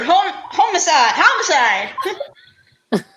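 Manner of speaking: stumbling over homicide while laughing; breaking into laughter. Real, not acted. Actor breaking character while naming his department, laughing. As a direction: laugh through the word homicide. Cracking up mid-line.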